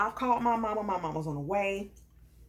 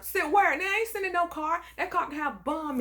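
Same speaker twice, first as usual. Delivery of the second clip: indignant comeback; 7 semitones higher than usual. Retold or imitated. Woman refusing his offer to sit down, high and indignant. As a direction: fire back in scornful disbelief.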